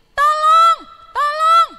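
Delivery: high, loud fake cry for help; parrot crying for help. Parrot fakes a call for help to its friends, high loud shout. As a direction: shriek for help like a tricky parrot. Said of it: "Dia suka teriak gini".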